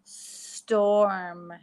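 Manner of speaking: "Storm" said as one smooth word after the split version; blended whole word. Shown as a demonstration. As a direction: blend the parts of "Storm" back together. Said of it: "St or m".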